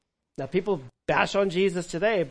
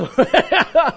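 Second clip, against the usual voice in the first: laugh; 12 semitones higher, 13 dB louder than usual. Acted. Mary clinging to Jesus.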